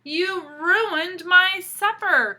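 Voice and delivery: loud high slow outraged cry; angry cry. Acted. Pig shouting at the wolf in his stew, slow loud angry cry. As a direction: wail at the wolf for spoiling dinner. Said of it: "cried the pig".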